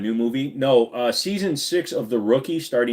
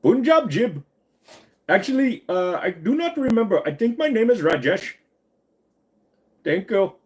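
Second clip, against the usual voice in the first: high-pitched mock Indian accent; 9 semitones higher than usual. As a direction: put on a comic Indian accent. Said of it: "Do some Indian accent".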